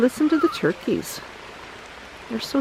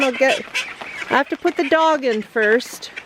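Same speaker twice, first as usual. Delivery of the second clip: ordinary delivery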